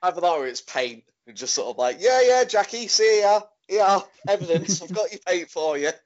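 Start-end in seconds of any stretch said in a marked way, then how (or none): 2.0-5.9 high, loud comic hail; hammed-up high-pitched call; acted calling out. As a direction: shout over to someone in play. Acting out a imagined film scene.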